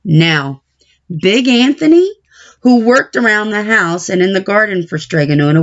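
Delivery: plain reading voice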